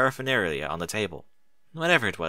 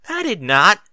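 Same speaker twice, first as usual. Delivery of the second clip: louder, higher huffy denial; indignant denial; 9 semitones higher, 8 dB louder than usual. Acted. Apple Bloom denying the tomato story, indignant and loud. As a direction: protest like an offended kid. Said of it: "crossed her forelegs and scowled".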